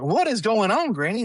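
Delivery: high-pitched retold bewilderment; startled question. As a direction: act out a baffled grandchild. Startled grandchild asks granny, retold.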